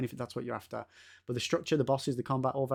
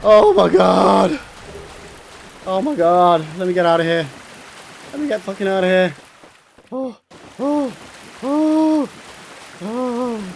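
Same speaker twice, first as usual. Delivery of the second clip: giddy relief; 4 semitones higher, 5 dB louder, slower than usual. Real, not acted. After the win.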